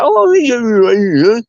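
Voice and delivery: loud, high, stretched-out mock yeah; exaggerated put-on reply. Exaggerated reply to baffled Americans.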